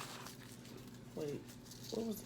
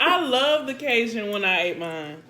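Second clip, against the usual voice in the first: laughing; 5 semitones higher, 8 dB louder than usual. Real, not acted. Laughing after the repeat.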